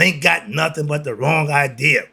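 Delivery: ordinary delivery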